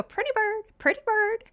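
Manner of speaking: high-pitched pet bird chirping words; talking cockatiel. Retold or imitated. Owner shows how her cockatiel used to talk, high mimicked bird voice. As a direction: squeak it out like a trained cockatiel. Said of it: "And then he would go".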